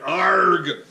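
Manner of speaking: loud, drawn-out pirate "arrr"; pirate growl. Shown as a demonstration. Instructor demonstrating an ab-tightening cue, loud pirate growl. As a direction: belt out one long pirate arg. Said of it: "The other one is a pirate".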